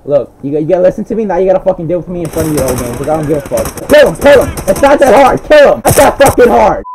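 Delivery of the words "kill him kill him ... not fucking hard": louder and higher yelling orders; furious shout of kill him; frustrated shout. Real, not acted. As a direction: bark it at the screen. Shouting at teammate during fight.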